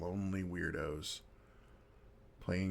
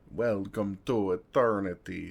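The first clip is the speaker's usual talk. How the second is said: mock grand vampire; 6 dB louder than usual. Mocking the vampire welcome speech.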